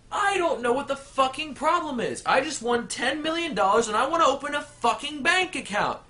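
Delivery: high, heated ranting customer; angry rant. Acted. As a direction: vent it at the manager. Customer rants to manager.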